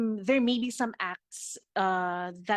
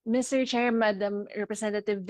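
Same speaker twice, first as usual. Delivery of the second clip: louder, clearly projected formal address; speaking up. Real, not acted. DOJ representative re-addressing the chair after a request, speaking up. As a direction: raise your voice so the chair can hear. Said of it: "can you please speak louder".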